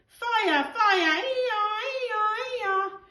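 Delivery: be a fire engine racing in; childish ee-yaw siren sound; siren noises. Acted. Luther playing fire rescue.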